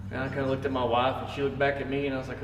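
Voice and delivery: normal talking voice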